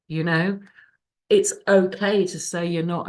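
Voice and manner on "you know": ordinary talk, nothing marked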